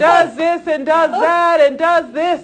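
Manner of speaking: a Pharisee boasting to God so that everyone hears; smug Pharisee prayer. Retold or imitated. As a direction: ham up a self-righteous prayer said for show. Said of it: "don't be like the Pharisees that pray in the open and says".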